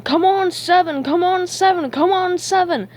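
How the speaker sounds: high, loud gambler's chant; loud excited cheering at the table; wild shouting. A narrator's reading. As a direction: shout it over and over, wildly. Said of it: "wildly shouting".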